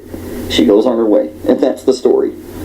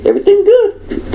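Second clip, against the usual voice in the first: squeaky high everything good; high mock question. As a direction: pitch it way up, fussy woman asking. Imitating customer asking.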